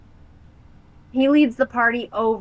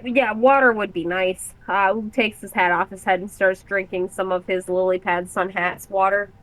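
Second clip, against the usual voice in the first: ordinary delivery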